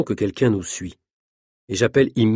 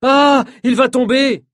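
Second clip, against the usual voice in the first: much higher, louder, slow cry of alarm; frightened cry; 14 semitones higher, 5 dB louder, slower than usual. Acted. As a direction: shriek as he loses his balance. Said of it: "crie Nina".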